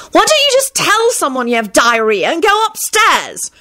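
high-pitched angry screech. A narrator's reading. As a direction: screech it out in exasperation. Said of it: "she screeched angrily".

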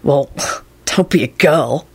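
slow, choked with a laugh; catch in voice. A narrator's reading. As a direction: hold back tears, laughing. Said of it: "with a catch in his voice".

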